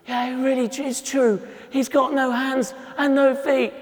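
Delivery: put-on high, pleased little boy; a small boy confirming his find, high; child's voice. Little boy talking to the passing man, high and pleased. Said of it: "he looked at me".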